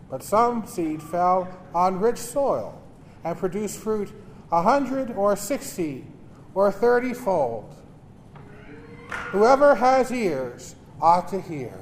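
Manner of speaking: solemn liturgical reading; Gospel reading. Acted. Proclaims the Gospel at Mass.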